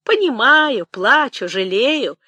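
high wail falling away word by word; keen like an old woman; old nanny's singsong lament. Acted. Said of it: "запричитала старуха".

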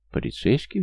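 high, rising echo question; surprised. A narrator's reading. Young mother hearing police are at her door, surprised question. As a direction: repeat it in surprise, pitch jumping up. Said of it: "удивилась Лера".

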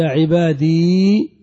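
عبادي said loud and slow with a long final ee; loud slow demo of عبادي with the ya kept; full form with ee. Shown as a demonstration. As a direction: stretch out عبادي, sounding the final ya. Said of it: "ثم حذفت ياء المتكلم".

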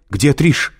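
high, loud urgent demand; shouting. A narrator's reading. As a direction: cry out the question in alarm. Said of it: "закричала Кэтрин".

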